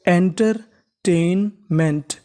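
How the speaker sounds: Entertainment very slow, broken into enter, टेन, meant; slow, in parts. Shown as a demonstration. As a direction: sound out entertainment part by part, pausing after enter and after टेन. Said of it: "तो meaning बन गई हमारी". (Entertainment को बहुत धीरे, एंटर-टेन-मेंट में तोड़कर बोलें)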